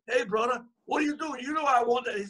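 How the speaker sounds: high-pitched mock caller complaints; imitating angry callers. Callers complaining to Ed Parker in a high mock voice.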